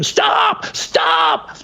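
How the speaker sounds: high desperate shout; screaming stop. Retold or imitated. Shouting at the driver to stop the bus, a high desperate yell. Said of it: "I scream".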